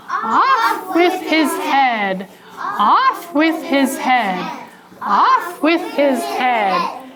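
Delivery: mean king. Acted. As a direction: proclaim it slowly like a tyrant king. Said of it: "along came that mean king".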